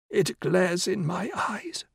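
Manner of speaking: high, slow frail whisper; old woman whispering. A narrator's reading. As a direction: complain weakly under breath. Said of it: "she whispered".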